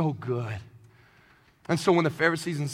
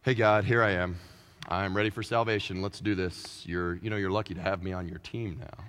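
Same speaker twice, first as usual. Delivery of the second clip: cocky believer; 12 semitones lower, 6 dB quieter than usual. Retold or imitated. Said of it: "come to God like".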